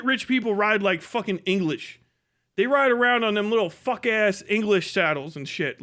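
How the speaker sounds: normal talking voice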